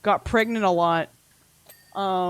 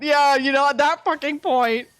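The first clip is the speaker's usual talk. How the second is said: loud, raised comic indignation; exasperated comic outburst; mock outrage; 5 semitones higher, 5 dB louder than usual. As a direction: push the joke out louder and higher. Riffing indignantly on vet's warning.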